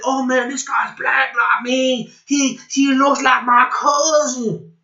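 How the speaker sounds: high-pitched mocking imitation; mock apologist. Mimicking apologists who claim him as family, mocking. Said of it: "always wanna say that".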